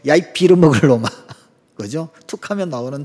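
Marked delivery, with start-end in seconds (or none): 0.1-1.1 loud mock granny scolding; scolding grandmother. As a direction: holler the curse like a TV grandma at her grandson. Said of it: "할머니가 손주에게 맨날 하는 말". (손주 야단치는 할머니 흉내)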